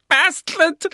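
high, loud rasping curse. Acted. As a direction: spit it out in a raspy old man's rage. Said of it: "the old man rasped".

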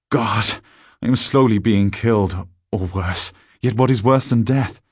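tormented, urgent outburst; anguished despair. Acted. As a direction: voice rising in desperate horror. Narrator despairs, trapped in another's body.

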